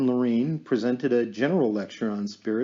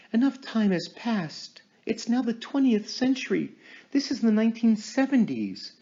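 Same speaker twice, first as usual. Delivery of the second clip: raised, intent medium in a trance; 8 semitones higher than usual. Acted. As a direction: speak as if channeling a spirit. Medium addressing the spirit.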